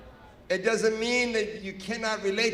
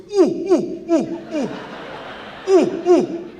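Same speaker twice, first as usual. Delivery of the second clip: high, loud mock-alarm "ooh ooh"; panicked hooting; 10 semitones higher, 8 dB louder, slower than usual. Friend spots police cars coming, panicked high hoots. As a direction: hoot in short falling bursts, imitating a freaked-out friend. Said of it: "my friend freaks out".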